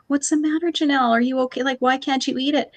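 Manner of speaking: put-on a mom gently coaxing her crying child; anxious motherly questions; worried mom. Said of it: "Shirley's like".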